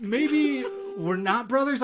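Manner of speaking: high, slow, sheepish take-back; nervous Sam retreating from his promise; backpedaling Sam. Retold or imitated. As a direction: squeak it out as if backing away from the offer. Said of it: "And Sam's just like".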